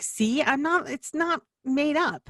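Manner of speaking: retelling her vindication with a lifted voice; triumphant told-you-so. Retold or imitated. Wife shows husband the episode, triumphant told-you-so.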